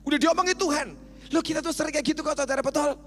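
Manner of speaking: high, loud revival preaching; preaching at full force. Acted. Preacher rousing the congregation at full force. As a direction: rouse the balcony crowd.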